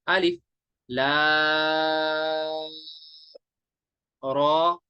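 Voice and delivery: "लाम" held very long, "रॉ" one beat; madd recitation. Shown as a demonstration. Teacher reciting the letters for students, drawn out. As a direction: stretch the vowel of "लाम" evenly between short "अलिफ" and "रॉ". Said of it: "ऐसे".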